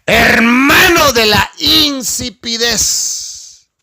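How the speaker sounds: high, slow drawn-out proclamation; slow preached cry; preached shout. Real, not acted. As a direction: proclaim it slowly to the church. Preacher's climax.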